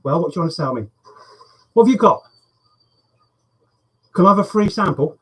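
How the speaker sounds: loud, annoyed grumble at the door; loud grumbling. Real, not acted. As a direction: grumble loudly at the caller. Door bell at weekend.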